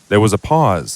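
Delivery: ordinary delivery, nothing marked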